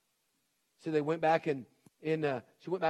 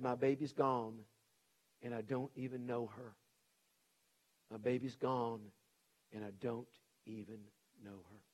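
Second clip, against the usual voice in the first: softer and softer, fading into broken grief; grief-stricken; 4 semitones lower, 6 dB quieter than usual. Retold or imitated. Father in the closet weeping for his daughter, fading grief. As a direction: let each line sink lower until it dies away.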